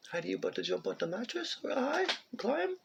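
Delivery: high, soft coaxing; high gentle pet voice; high soft pet-talk to the cat. Real, not acted. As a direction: coax the kitty to climb in a high voice. Coaxing cat onto mattress.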